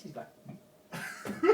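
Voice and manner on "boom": sudden loud imitation blast; mouth-made cannon boom. French guy adding sound effects to a kick, mouth-made boom. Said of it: "like a, a movie with sound effects".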